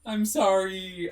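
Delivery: slow apology through real laughter; laughing apology. Real, not acted. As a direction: say sorry while cracking up. Laughing apology after cheeky remark.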